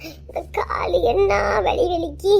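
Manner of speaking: put-on slow, soft lament of a tired old mother; groaning old mother.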